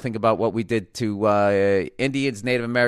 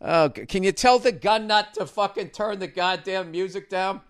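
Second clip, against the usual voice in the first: high, nagging wife voice, far from his own; mocking wife; 10 semitones higher than usual. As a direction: mimic an annoyed wife, pitched way up. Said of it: "my wife just looks at me like".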